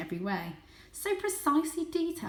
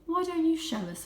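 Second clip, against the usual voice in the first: lightly voiced elderly cat, a touch higher; a hint of old grandfather asking the kitten to lead; 10 semitones higher, 4 dB louder than usual. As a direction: coax gently like a wise old cat.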